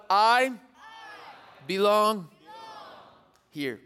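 loud, drawn-out call for the crowd to repeat; call and response. Shown as a demonstration. Youth pastor leading students in a loud call and response. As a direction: lead the room in a loud chant. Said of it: "Repeat after me out loud".

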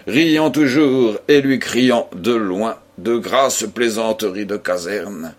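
plain reading voice

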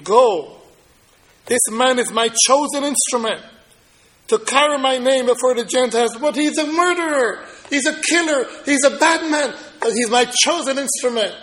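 loud, high, fervent preaching; voicing Jesus's call, rising to a preaching shout; preaching at full force. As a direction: lift the room with the words of Jesus.

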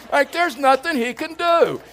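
high, loud comic wail; exaggerated tearful whine, played for laughs; mock hysterical crying. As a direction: blubber it in hammed-up despair. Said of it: "cried hysterically with them".

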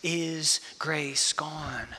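very slow, each word weighed; slow searching question. Acted. Pastor asking the grieving church a question, very slow. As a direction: let the question hang in the air, slowly. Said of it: "is grace gone is a real question".

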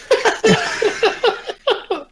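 very high giggle; high laugh. Real, not acted. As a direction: let out a squeaky laugh.

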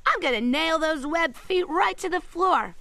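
ordinary talk, nothing marked

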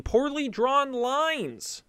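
slow, high title reveal; grand announcement. Real, not acted. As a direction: unveil the title slowly. Announcing his pick title.

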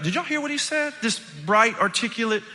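high-pitched, amazed bragging; blown away. Retold or imitated. Pastor praising the kid to the class, amazed. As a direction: show off the answer in a high, thrilled voice. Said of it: "I'm, like, blown away".

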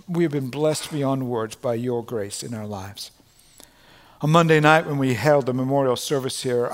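ordinary delivery

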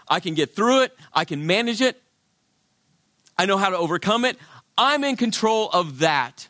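high, insistent bragging; cocky self-assurance. Pretend. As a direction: sure nothing can touch you. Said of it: "thinking to yourself".

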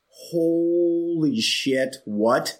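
long drawn-out, very slow amazement; slow-motion awe; stunned disbelief. Retold or imitated. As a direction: stretch the first word right out, then question it. Acts out stunned reaction to the scene.

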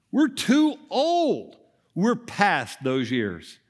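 high, slow, mock-weary old woman's protest; a hint of a tired old wife saying no; Sarah's doubt. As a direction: lightly voice Sarah, drawn out and high.

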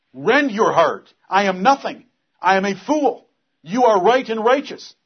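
loud, high, impassioned preacher; fiery preaching. Acted. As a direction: thunder it from the pulpit. Preaching at full force.

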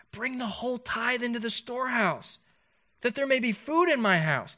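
lifted preacher's voice, slowing on test me; God speaking. Acted. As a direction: ring it out as God's own command. Preacher voicing God in Malachi.

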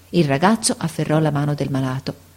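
usual speaking voice

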